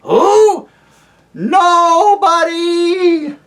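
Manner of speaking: high, slow wailing hoot. Acted. Owl to the deaf dwarf, high wailing hoot. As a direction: hoot it out with a cry in it.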